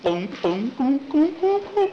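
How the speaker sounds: imitated gulps climbing high and loud; rising comic glug-glug noises; gulping milk. Jackie downing a glass of milk, gulping noisily. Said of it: "He took a glass of milk".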